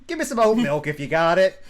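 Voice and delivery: lightly voiced cowboy asking for oat milk; a hint of a rough cowboy at the counter; mock cowboy. Cowboy bit continues, ordering drinks.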